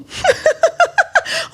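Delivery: high, rising witch's cackle; evil cackle. Acted. The sorceress cackles over her captive, shrill and rising. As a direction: cackle up high with wicked glee. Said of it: "méchant sourire".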